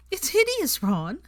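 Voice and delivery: high girlish mock horror; Hermione voice. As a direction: squeal it out, hideous. Hermione mocking the jumper.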